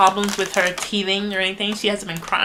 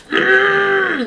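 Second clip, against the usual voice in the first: put-on much higher, louder frustrated baby grunt; imitated baby grunt; 13 semitones higher, 8 dB louder than usual. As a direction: grunt like a baby who can't get her way. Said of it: "she just grunts".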